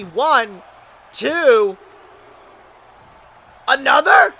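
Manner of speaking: excited ringside play-by-play; wrestling commentator. Acted. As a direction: call the match live, hyped.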